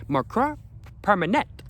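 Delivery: French accent. Shown as a demonstration. Vlogger reading the French label to viewers, French accent. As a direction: put on a high French accent, word by word. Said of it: "enjoying my French accent".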